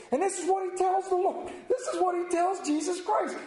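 high-pitched, impassioned disbelief; worked-up, high preacher's exclamation; incredulous preaching. Real, not acted. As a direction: preach it in rising outrage at the nerve of it. Preacher incredulous at Peter's nerve.

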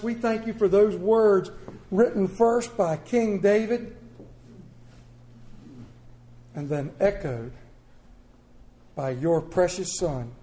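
preacher leading prayer aloud; fervent prayer. Acted. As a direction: pray it over the congregation. Leads congregation prayer.

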